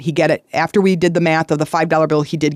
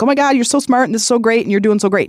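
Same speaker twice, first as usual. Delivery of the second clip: put-on much higher, gushing over the kid; big excited praise; 6 semitones higher, faster than usual. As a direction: make a huge fuss over him, pitch up high. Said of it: "we make this big deal".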